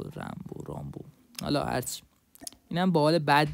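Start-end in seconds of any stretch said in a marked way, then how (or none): 0.0-0.6 "رامبو" as RAM-bo, dropped soft; Rambo with front a. Shown as a demonstration. Gamer repeating the skin's name, "رامبو" as soft RAM-bo. Trying out two pronunciations of name. 0.7-1.0 "رامبو" as ROM-bo, low and soft; Rambo with back a. Shown as a demonstration. Gamer repeating the skin's name, "رامبو" as soft low ROM-bo. Trying out two pronunciations of name.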